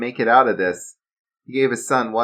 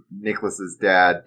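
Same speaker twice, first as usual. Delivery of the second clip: ordinary delivery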